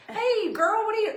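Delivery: high, loud, bubbly greeting; mock friend's voice text; imitating friend. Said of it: "She's like".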